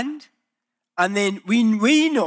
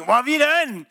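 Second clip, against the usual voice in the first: whining; 5 semitones higher than usual. Retold or imitated. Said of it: "people come whining".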